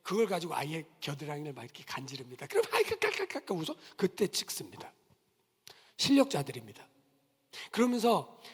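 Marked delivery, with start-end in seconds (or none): 2.5-3.7 squeaky high quick mock child's laugh; high giggling child. Photographer describes the tickled child, giggling high.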